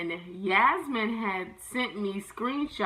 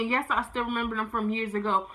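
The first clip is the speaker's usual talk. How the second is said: normal talking voice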